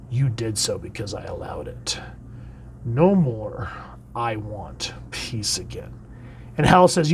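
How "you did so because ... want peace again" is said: eerie whisper. Acted. Necron tells the resurrected heroes, eerie whisper. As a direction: breathe the lines out like a patient reaper. Villain Necron's menacing speech.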